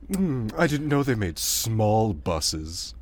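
slow, snooty posh drawl; a rich snob puzzled by buses. Pretend. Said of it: "in a posh voice".

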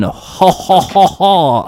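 slow, spaced-out evil "ha ha"; hammed-up foreign villain laugh; mock villain laugh. Villain gloating after his threat, mock laugh. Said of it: "I'm French now".

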